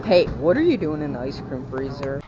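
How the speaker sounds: loud call at the freezer; shouting. Real, not acted. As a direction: shout it at full volume. Yells at the freezer.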